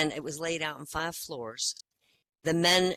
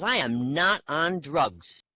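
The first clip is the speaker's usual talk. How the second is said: slow indignant denial; 5 dB louder, much slower than usual. Acted. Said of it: "And the bear says".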